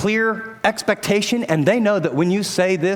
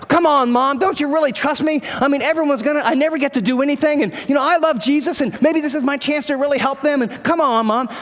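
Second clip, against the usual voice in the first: put-on whiny teen pleading; 8 semitones higher than usual. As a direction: beg your mom like a wheedling kid.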